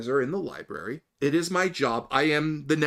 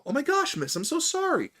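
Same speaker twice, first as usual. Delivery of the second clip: high-pitched, gushing apology; acted flustered over-apology to smooth things over; apologetic damage control; 11 semitones higher than usual. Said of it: "immediately go into damage control".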